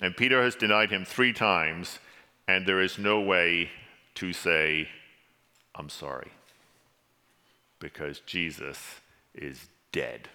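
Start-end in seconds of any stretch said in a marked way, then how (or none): 4.2-10.3 low, very soft, with heavy pauses; hushed and solemn. Real, not acted. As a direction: let the words sink into grief-laden quiet. Solemn telling of Jesus's death.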